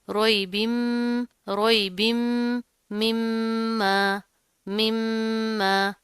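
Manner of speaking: रोइबिम slowly, humming the final m; ghunnah on m. Shown as a demonstration. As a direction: press and hold the double m of मिम्मा. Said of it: "गुन्ना करेंगे". (मिम्मा में मीम जमा कर गुन्ना)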